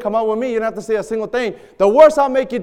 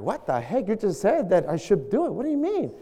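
baffled protest; 7 dB quieter than usual. Retold or imitated. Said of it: "And they're like".

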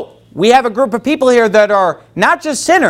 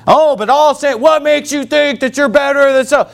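mocking objector; 7 semitones higher than usual.

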